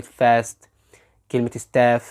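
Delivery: "fast" and "staff" with the "ae" of "cat"; American short A. Shown as a demonstration. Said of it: "ده نطق أمريكان".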